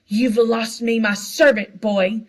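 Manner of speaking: put-on loud, slow, menacing snarl at the boy; furious outburst. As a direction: roar it as you lunge at him. Malfoy lunges at Harry.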